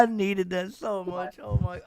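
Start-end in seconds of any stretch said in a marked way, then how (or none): none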